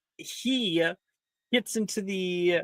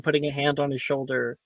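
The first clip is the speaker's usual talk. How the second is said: ordinary delivery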